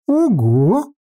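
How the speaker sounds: high, impressed ogo; impressed surprise. Acted. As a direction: sound genuinely impressed. Dragon reacts to names.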